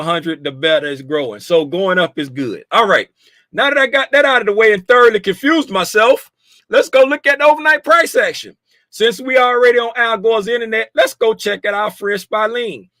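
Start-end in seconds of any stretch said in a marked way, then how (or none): 3.5-12.9 excited. Real, not acted. Hype switch to price action.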